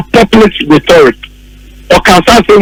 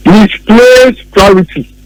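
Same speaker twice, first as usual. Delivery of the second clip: MISPLACED PRIORITY drawn out slowly; slow emphasis on misplaced priority; much slower than usual. Shown as a demonstration. Host repeating the ex-president's words with slow weight. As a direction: drag out "Misplaced priority." word by word. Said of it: "That is how he described it".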